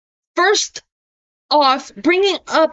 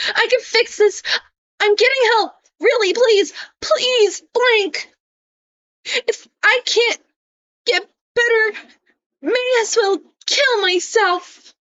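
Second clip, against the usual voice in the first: pleading mock cry, pitched way up; mock whiny Isaac; 6 semitones higher than usual. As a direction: ham up a needy friend begging not to be left. Reading Isaac's pleading messages aloud.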